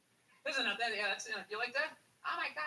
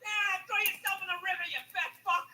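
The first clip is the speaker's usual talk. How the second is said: yelling at TV; 8 semitones higher, 6 dB louder than usual. Retold or imitated.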